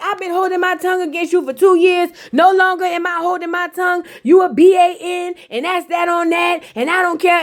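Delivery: Shay finally telling Fabo off, high mock rant. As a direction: unload two years of grudges, hammed up. Shay runs up and blows up.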